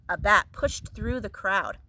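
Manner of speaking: usual speaking voice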